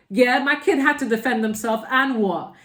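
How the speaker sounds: loud, defiant and high, daring anyone to object; defiant parent. Retold or imitated. Parent defending child in front of others, loud and defiant. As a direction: stick up for your kid, chin out and loud.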